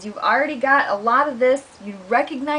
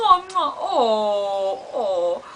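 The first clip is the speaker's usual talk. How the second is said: fake sob. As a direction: end on a kitten sob. Crying kitten ends.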